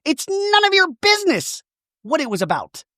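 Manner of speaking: very high, indignant retort; mock snapping Shannon. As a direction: fire it back high and offended. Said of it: "Shannon's like".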